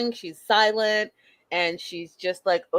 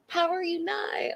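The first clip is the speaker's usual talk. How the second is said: cartoon catchphrase; 8 semitones higher, 6 dB quieter than usual.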